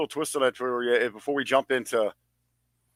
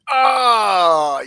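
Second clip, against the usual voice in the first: long, high, loud groan; loud wail; 7 semitones higher, 10 dB louder than usual. Real, not acted. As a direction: wail it out at full volume. Mock outrage at missing upload.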